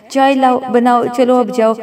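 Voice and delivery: ordinary delivery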